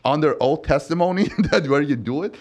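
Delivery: mock telling off the client; retold yelling. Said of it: "I was yelling at him".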